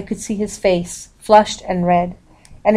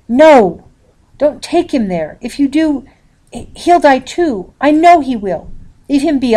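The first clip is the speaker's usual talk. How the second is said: desperate plea; 7 semitones higher, 5 dB louder than usual. A narrator's reading. Girl pleads to keep father.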